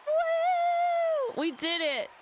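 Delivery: high, loud whoop of victory; excited cheer. Real, not acted. Streamer celebrating a won trial, loud high cheer. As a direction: cheer it out like you just won. Celebrating winning the court case.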